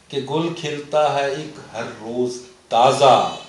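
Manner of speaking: slow, measured mushaira recitation; poetry recital. Acted. Poet reciting comic mixed-language verse to the host, slow recital. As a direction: draw each line out, leaning on the rhyme.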